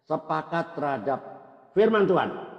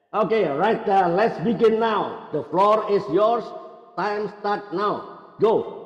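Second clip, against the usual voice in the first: gaya pembawa acara; 8 semitones higher, 7 dB louder, much slower than usual. Acted. Membuka giliran bicara bak pembawa acara.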